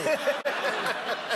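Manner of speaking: high burst of real laughter. Real, not acted. Guest cracking up at his own story, real laugh. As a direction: break up laughing.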